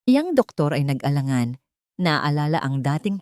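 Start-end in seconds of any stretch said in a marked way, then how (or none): none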